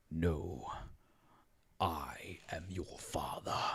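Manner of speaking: slow, low, whispery villain; Darth Vader. Acted. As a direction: sink deep and hush each word. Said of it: "And then the famous quote".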